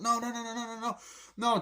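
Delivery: high, rapid string of no's; yelped pleading, played for fun; mock panic. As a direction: imitate a game hero panicking. Acting Mario panicking as he's attacked.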